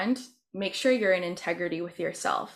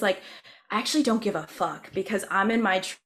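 ordinary talk

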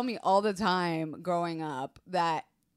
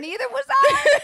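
laughing; 10 dB louder than usual. Real, not acted. Laughing reply.

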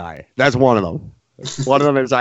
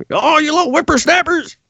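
put-on cranky old geezer, much higher; old prospector; 14 semitones higher than usual. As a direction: wheeze it like a grumpy old-timer.

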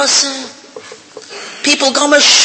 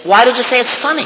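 ordinary delivery

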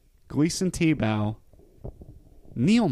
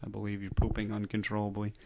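normal talking voice

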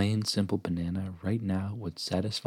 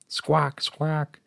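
high-pitched toucan squawk; bellowed squawk; 7 semitones higher than usual. Acted. Toucan interrupts from a high perch, bellowed squawk. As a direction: squawk it like a startled bird. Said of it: "a toucan bellowed from a high perch".

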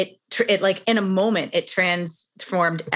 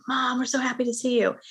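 quick, higher, lightly voiced excited kids; kids super excited; 6 semitones higher, faster than usual. Kids run up to tired mom, quick excited greeting. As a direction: bubble over like eager kids greeting mom. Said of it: "like, super excited".

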